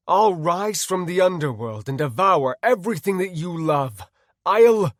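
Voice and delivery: loud, high, raging threat; furious yelling, building to a cut-off; defiant scream. Acted. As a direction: scream defiance in his face. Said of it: "I screamed in his face".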